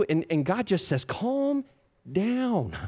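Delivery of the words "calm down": calming. Acted. God calming the panicked.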